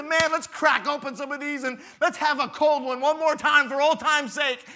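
high, loud party-friend voice; mock cheerful drinking buddy; beer buddy. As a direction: toast the dead friend. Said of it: "He said".

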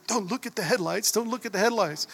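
imitated panicky inner voice; mock fear. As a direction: act out gripping fear, repeating it to yourself. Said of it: "holy fear gripping me".

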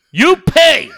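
high, loud scammer demand; mock shout. Scammer shouts at the caller to pay, mock shout. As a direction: bark it like the angry scammer.

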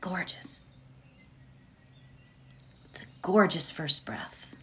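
hushed whisper of praise. Acted. Guide praising the listener's breath in a whisper. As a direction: breathe the words unvoiced.

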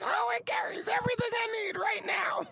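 high airy whisper; breathy whispered imitation of a girl. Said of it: "she's like".

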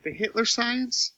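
tiny, high, hushed voice; barely audible high-pitched question; very quiet. Real, not acted. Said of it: "Why are you so quiet?".

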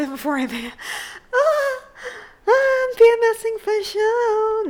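high crying laugh; tearful high laugh-cry. Real, not acted. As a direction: laugh through tears.

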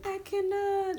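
high, sustained cry of disbelief; mock exasperation. Real, not acted. Co-host reacting to her friend's rant, high mock-exasperated wail. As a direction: wail it high, drawn on one note.

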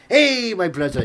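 loud, high mock greeting; big retold hello, high and loud; hailing shout. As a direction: call out heartily in a German accent.

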